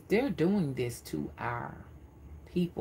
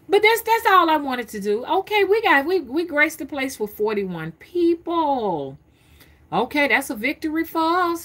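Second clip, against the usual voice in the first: pleased announcement; 10 semitones higher, 6 dB louder than usual. Real, not acted. Celebrating the viewer count on stream.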